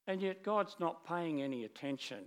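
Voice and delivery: ordinary delivery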